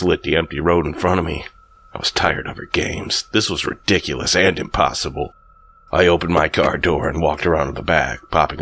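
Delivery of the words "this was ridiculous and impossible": usual speaking voice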